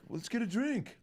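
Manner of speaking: mock celebrity tossing off a compliment; high amused older man.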